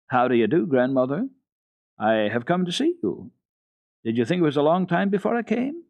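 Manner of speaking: put-on a small girl greeting the old grandmother; a lightly voiced girl, eager and friendly; cheerful little girl. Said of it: "She went straight to the spinning wheel and said".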